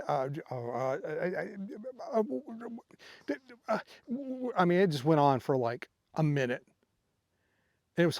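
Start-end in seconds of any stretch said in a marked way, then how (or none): 0.1-3.8 imitating stammering [name]. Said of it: "just this clip of him".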